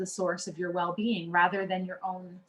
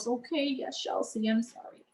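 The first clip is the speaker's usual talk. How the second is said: meek apology; 2 semitones higher, 5 dB quieter than usual. Retold or imitated. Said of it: "Feel two inches tall".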